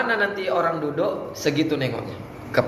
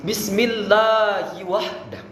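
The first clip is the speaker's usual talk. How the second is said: slow, raised, drawn-out recitation; measured Arabic opening; 4 semitones higher, much slower than usual. Shown as a demonstration. Coach models the opening for students, slow and measured. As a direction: stretch each syllable of the opening.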